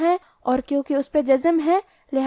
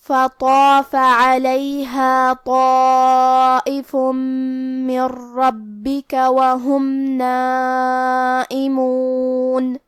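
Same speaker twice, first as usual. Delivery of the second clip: slow, louder tajweed chant; Quran recitation; 3 dB louder, much slower than usual. Acted. Teacher reciting the next verse, slow chant.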